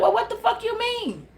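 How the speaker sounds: high, laughing mock outrage; indignant mock quote. The angry person reacting, high laughing mock outrage. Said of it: "She like".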